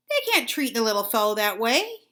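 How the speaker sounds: ordinary delivery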